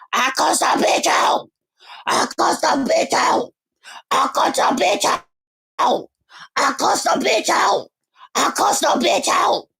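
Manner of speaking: high, hoarse shouted boast in mimicry; croaky, high-pitched mock bragging; dry-throated mocking. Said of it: "listen to this dry throat, ashy throat bitch".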